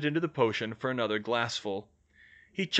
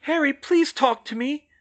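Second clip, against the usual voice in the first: high, loud anxious plea; raised voice; 7 dB louder than usual. A narrator's reading. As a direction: call out pleading for an answer. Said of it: "raising her voice a little to be heard".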